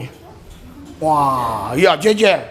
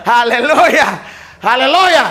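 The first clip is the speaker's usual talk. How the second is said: each hallelujah higher and louder, building; 4 semitones higher, 4 dB louder, slower than usual. Real, not acted. Preacher rousing the congregation, shouting hallelujah louder each time. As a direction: lift the crowd with rising praise.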